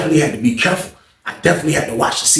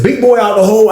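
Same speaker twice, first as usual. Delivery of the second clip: taunting call; 8 semitones higher, 4 dB louder than usual. Retold or imitated. Teasing the suspected snitch.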